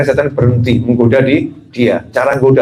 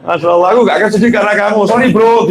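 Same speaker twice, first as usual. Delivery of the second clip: cheerful mock forgiveness; 5 semitones higher than usual. Cheerfully addressing the thief.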